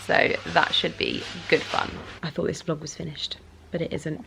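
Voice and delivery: hushed late-night whisper. Real, not acted. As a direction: whisper so nobody wakes. Whispering late vlog aside.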